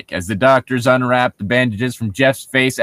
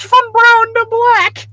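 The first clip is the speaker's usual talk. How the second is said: much higher, slow hammed-up shout; mock dramatic shout; 5 dB louder, slower than usual. Mocking the story's bad science, a slow, much higher hammed-up shout. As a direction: ham up the drama, slowly.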